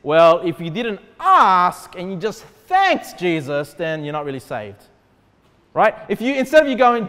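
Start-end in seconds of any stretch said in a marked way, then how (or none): 0.0-4.8 slow, drawn-out mock objection with a long "ask"; mock pedantry, dropping low at the end; mocking objectors. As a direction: imitate a pedantic objector, stretching the key words. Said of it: "some people that say this".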